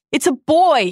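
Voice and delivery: high, loud joyful announcement; excited cry. Retold or imitated. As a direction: burst out with the happy news. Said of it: "he cries out".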